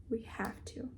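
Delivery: breathy whispered resolve; a soft whispered we have to. Real, not acted. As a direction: whisper it to yourself, determined. Whispered repeat to herself.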